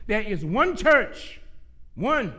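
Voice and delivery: loud, high, emphatic preaching; full-force preaching. Real, not acted. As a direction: drive it home to the congregation, loud and high.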